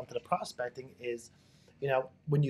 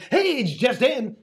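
higher, louder, slow over-the-top hello; hyper phone greeting; 8 semitones higher, 6 dB louder, slower than usual. Shown as a demonstration. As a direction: demo an overly pumped caller. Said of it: "if you're talking like this".

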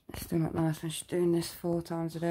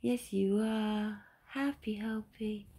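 put-on slow, lilting sing-song to a child; baby talk; 5 semitones higher, much slower than usual. Mother cooing to her little girl after a seizure, slow baby talk. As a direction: coo it gently and slowly.

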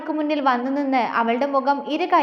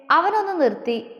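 ordinary delivery, nothing marked

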